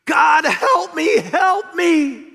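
high, loud, slow desperate shout; crying out to God. Acted. Jack hanging from a branch, shouting up to God. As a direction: call up to heaven with everything. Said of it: "he's gonna cry out to God".

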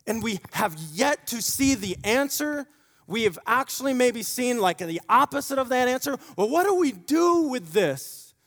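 loud, high impassioned preaching; full-force preaching. Acted. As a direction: preach it hard with rising passion.

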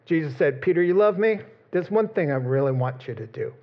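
ordinary delivery

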